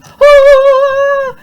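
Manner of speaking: high, loud frightened scream; child's scream. Acted. Boy chased by dogs, loud high scream. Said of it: "Billy Poke screamed".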